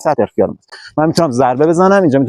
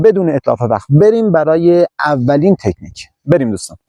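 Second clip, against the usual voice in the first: ordinary talk, nothing marked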